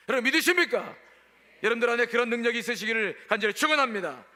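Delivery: fervent preaching. Real, not acted. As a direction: press the congregation for an amen. Preaches at full force. (힘 있게 외치는 설교)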